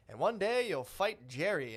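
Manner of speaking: mock children's-book propaganda read, drawn out; put-on English storybook reading; storybook narrator. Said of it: "with a book being like".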